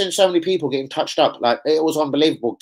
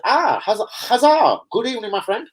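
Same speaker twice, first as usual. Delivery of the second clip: excited greeting; 8 semitones higher than usual. Real, not acted. Greeting a viewer named Hazard.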